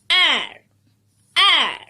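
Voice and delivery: "Air" as one falling sound; isolated ending sound. Shown as a demonstration. Teacher gives children the last sound, isolated "Air". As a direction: sound out "Air" alone, pitch sliding down. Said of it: "What is the last sound?".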